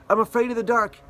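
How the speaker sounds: high, loud mock scared voice; scared child. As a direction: whine it like a frightened kid.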